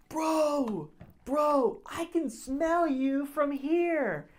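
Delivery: high, drawn-out mock holler; cartoonish drunken taunt. Drunk friend taunting a huge stranger, cartoonish holler. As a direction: stretch it out like a cartoon heckler. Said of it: "something out of a cartoon".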